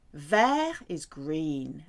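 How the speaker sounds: normal talking voice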